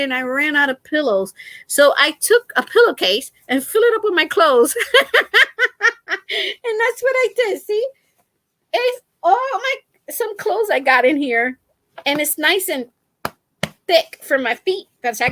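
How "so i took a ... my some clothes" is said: high, laughing through the words. Real, not acted. Vlogger confessing her pillowcase fix to viewers, laughing. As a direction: tell it while cracking up.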